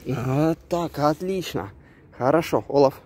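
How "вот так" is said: ordinary talk, nothing marked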